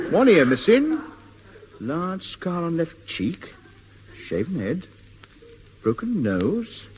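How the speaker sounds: halting list, each item swooping up like a question; dawning recognition. Acted. Man sizing up a stranger against a convict's description, slow questioning realisation. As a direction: tick off each feature with a rising, drawn-out lilt.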